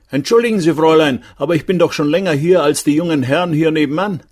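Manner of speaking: high-pitched, indignant guest; protesting guest. A narrator's reading. (empörter Gast, hohe Stimme)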